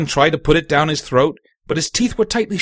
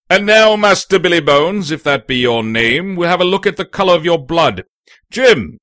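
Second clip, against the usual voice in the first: raised, firm doctor voice; loud, businesslike physician; brisk doctor, authoritative; 3 semitones higher, 4 dB louder than usual. Acted. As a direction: address patient and helper with command.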